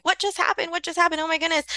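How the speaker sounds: high, frantic flustered cry; mock panic. Teacher mimicking a flustered adult, high mock panic. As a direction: play the overwhelmed adult. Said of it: "being like".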